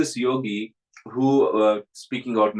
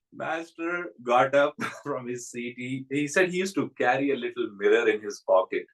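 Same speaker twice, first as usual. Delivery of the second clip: normal talking voice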